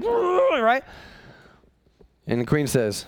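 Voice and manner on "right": dim king. Acted.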